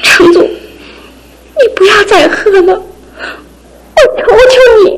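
tearful pleading. Acted. Wife begs husband, crying.

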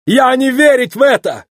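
high, thrilled foreigner; overjoyed Italian shopkeeper in broken speech; excitable Italian. A narrator's reading. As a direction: exclaim in high-pitched disbelief. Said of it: "рассмеялся итальянец".

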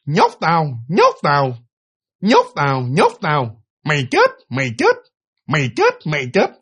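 parrot-like cry in short, separated bursts; talking-bird squawk. Retold or imitated. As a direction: imitate a bird repeating human words, phrase by phrase. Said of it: "con két này nọ đồ nó kêu chưa". (giọng két nhại tiếng người)

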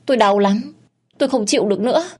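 grovel and beg, voice rising; husband begging his wife to stop hitting him, terrified. Acted. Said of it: "Nghe chồng van xin".

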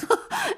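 a sudden high, bright laugh; high burst of laughter. Acted. Sally laughing off the doctor's scruples, high laugh.

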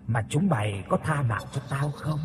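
hushed accusing spirit voice; vengeful ghost. A narrator's reading. Ghost at dying man's ear.

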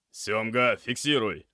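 sharp command. A narrator's reading. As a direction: order an assistant into action. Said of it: "скомандовал Алексей".

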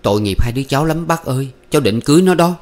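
high-pitched, earnest appeal; pleading. Acted. Young man begs uncle for the couple's sake, pleading. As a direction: beg the elder to take pity.

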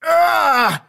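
high, loud agonised shout; death cry. Acted. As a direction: cry out from a wound. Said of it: "чтобы снова закричать".

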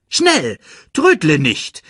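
high, loud urgent call. A narrator's reading. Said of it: "rief Rafiki". (drängend rufen)

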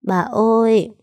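high, tearful little cry of Grandma; choked-up child's call. Acted. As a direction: call out to grandma through a lump in the throat. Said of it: "nghẹn ngào gọi". (gọi nghẹn ngào, giọng trẻ con cao)

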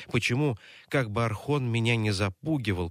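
plain reading voice

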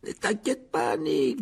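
high-pitched jovial ghost; Sir Patrick. A narrator's reading. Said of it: "dit la tête de Sir Patrick". (voix aiguë et goguenarde)

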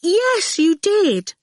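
put-on a small girl backing up her father; prompt child voice. A narrator's reading. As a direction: chirp it out promptly. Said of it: "she said promptly".

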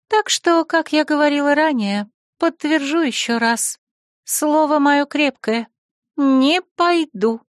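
high, steady refusal; firm. A narrator's reading. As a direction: state it resolutely, slowing right down on the last words. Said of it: "твёрдо закончила она".

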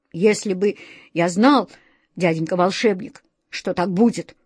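plain reading voice

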